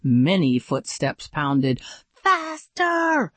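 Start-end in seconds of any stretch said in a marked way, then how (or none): 2.2-3.3 high urgent call; high panicked faster; shouted. A narrator's reading. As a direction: urge him on, pitched high. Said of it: "Jeremy shouted".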